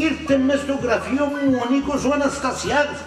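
high agitated rant. Acted. Boss raging, voice rising high.

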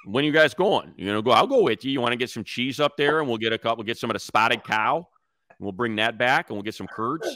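put-on light Chicago accent, going up to Wisconsin; lightly put-on Chicago accent, friendly and easygoing. Inviting a buddy up to Wisconsin, light Chicago accent. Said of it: "So with the Chicago accent".

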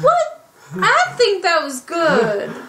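high, loud disbelieving laugh; indignant and laughing at once; surprised outrage. Real, not acted. Defending a movie she loves.

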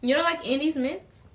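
talking between bites; chewing while talking. Real, not acted. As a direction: mouth partly full. Seen 0: Woman, turned to partner, holds bitten mint piece, mouth working.

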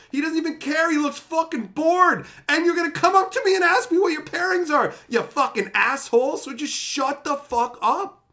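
high, loud mock tirade; mock rant. Organizer rants at a bored player who ignores the pairings, high and loud. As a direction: tear into the bored player at full pitch.

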